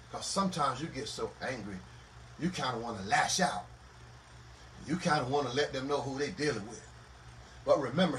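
low, soft, measured counsel; quiet, grave preacher's voice; hushed solemn preaching. Real, not acted. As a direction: bring it right down and let it settle.

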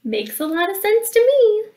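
high, loud little cub; baby bear. Acted. Baby Bear agreeing to the carpool, high child voice. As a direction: pipe up high like a small bear. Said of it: "said Baby Bear".

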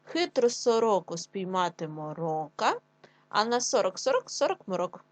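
slow, deliberate tongue twister; slow teacher read for children; slow careful reading. Shown as a demonstration. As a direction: take it slowly and clearly. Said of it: "Ще раз разом, швидше".

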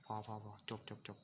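ordinary talk

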